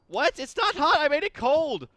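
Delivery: can't believe it didn't work; real shocked protest; indignant disbelief. Real, not acted. Shocked when item still hot.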